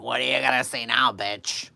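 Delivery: put-on loud, sloppy drunken taunt; drunk mother taunting. Drunk mother taunting the neighbor across the street, slurred and loud. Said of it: "All drunk as a skunk".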